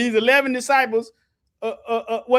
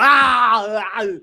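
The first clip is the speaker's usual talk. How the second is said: loud, repeated berserk yells; Arnold-style roar; 6 dB louder than usual. Retold or imitated. Preacher showing how Arnold goes berserk, loud repeated yells. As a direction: bellow like Arnold losing it. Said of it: "Arnold go berserk".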